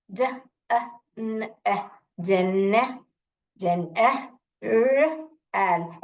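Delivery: ज, अ, न sound by sound with gaps. Shown as a demonstration. Teacher shows how to read a big word, separate sounds ज, न, र. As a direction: break जनरल into single letter sounds and join bit by bit. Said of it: "ऐसे तोड़ जोड़ के पढ़े".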